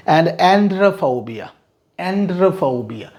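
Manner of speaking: androphobia very slowly, en-dro-pho-bia; model pronunciation. Shown as a demonstration. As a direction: drill androphobia for students. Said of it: "vocabulary drill, repeated slowly".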